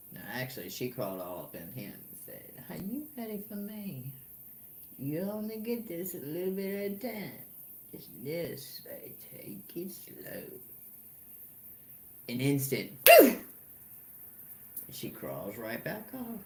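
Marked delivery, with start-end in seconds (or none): 2.7-7.3 higher, breathy-free teasing seductress voice; seductive sun. Retold or imitated. 7.9-10.4 slow whispered tease; whispered seduction. Retold or imitated. Sun voiced seductively by teller. 13.1-13.4 high, loud hammed-up phew; exaggerated phew. Sun acting spent after.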